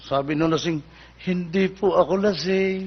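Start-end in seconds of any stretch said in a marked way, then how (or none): 1.2-2.9 slow, drawn-out polite denial; acted drunk being oh-so-gentle with the cop; meek and well-behaved. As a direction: plead innocence softly and slowly. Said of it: "Aba, mabait naman to".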